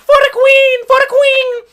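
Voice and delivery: very high, loud tiny war cry; shrill it out like a charging bee; bee battle cry. Acted. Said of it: "The bees that are like".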